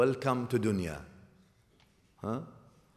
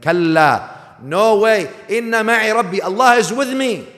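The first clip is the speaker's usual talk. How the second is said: loud, higher, utterly certain refusal; insistent declaration; 10 semitones higher, 12 dB louder than usual. Acted. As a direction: declare it with unshakable faith. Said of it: "insists and tells them".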